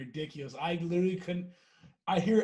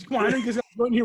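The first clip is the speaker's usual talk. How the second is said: put-on pushy fast kid; 5 semitones higher, 5 dB louder, much faster than usual. Kid barging into RV.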